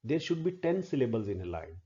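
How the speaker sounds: ordinary delivery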